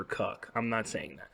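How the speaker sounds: usual speaking voice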